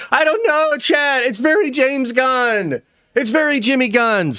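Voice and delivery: high, drawn-out mock whine; hammed-up doubt. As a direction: stretch it out high as exaggerated hesitation.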